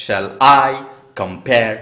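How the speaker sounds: loud beats on "I" and the second syllable of "compare"; iambic stress. Shown as a demonstration. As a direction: punch I, then com-PARE after a pause. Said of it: "বুঝতে পারছো বিটটা". (I আর pare-এ জোর)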